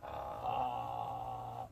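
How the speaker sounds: deep creaky vocal fry. Shown as a demonstration. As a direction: creak it low and slow. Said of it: "Do it again".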